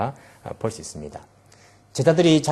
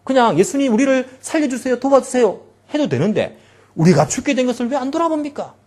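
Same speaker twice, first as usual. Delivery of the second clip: much higher, aggrieved protest; resentful complaint; 10 semitones higher, 3 dB louder than usual. Retold or imitated. Disciples waking Jesus, resentful and pleading. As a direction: rail at him for not caring. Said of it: "원망과 불평 섞인 그런 감정".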